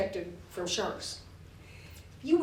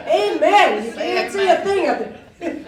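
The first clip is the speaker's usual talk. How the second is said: high, loud, laughing gospel call; church-revival response, half laughing; revival preacher; 8 semitones higher, 10 dB louder than usual. Retold or imitated. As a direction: shout it like a preacher at a tent revival. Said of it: "Pauline's like at the revival".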